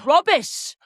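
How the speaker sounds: high, put-on British voice for "Rubbish"; British accent. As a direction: echo it like a posh Brit. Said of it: "say everything British we can think".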